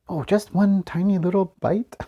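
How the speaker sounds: high, wheedling waiter voice; coaxing waiter. Retold or imitated. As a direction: tempt him with a sweet offer. Waiter in Monty Python sketch.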